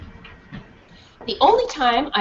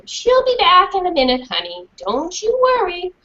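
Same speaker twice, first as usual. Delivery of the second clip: soothing teacher; 9 semitones higher than usual. Acted. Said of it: "suggested the dark-haired teacher".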